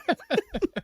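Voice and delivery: a very high, loud laughing squeal; high squeal of laughter. Real, not acted. Laughing over dad nearly slamming the guy, high squeal.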